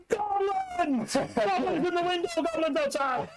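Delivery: very high, drawn-out alarm yell. Acted. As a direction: raise the alarm to the whole hall. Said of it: "Then, then I just yell".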